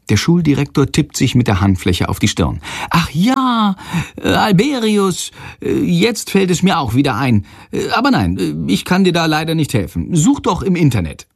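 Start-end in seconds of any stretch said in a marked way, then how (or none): 2.9-11.3 high, impatient voice; hurried headmaster. A narrator's reading. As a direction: brush the boy off, busy. Headmaster bluffing remembrance.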